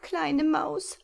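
high pleading owl, rising at the end; trapped owl pleading with the little mouse; desperate plea. Acted. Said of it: "bat sie verzweifelt".

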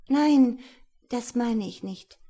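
high loud flustered denial; hectic protest. A narrator's reading. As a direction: fend him off in a rush. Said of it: "wehrte Melanie hektisch ab". (hektische Abwehr)

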